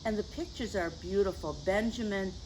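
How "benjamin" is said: usual speaking voice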